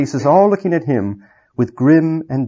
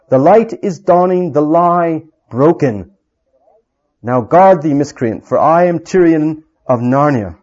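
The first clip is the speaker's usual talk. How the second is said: king challenges the soldier, loud and proud; battle cry; 3 semitones higher, 5 dB louder than usual. Acted. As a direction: proclaim it as a war cry.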